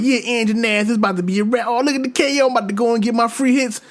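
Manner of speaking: high-pitched imitation of cocky excitement; mock gloating hype. Gamer replaying his overconfidence before the monster arrives, gloating. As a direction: crow it high, like victory is already yours.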